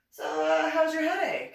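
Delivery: high, loud, put-on question. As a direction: call it out as if over club music.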